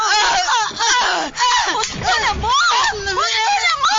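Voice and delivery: high, loud desperate yell; shouting to break up a fight; pleading shout. Acted. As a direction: scream at them to stop. Begging the fighters to stop.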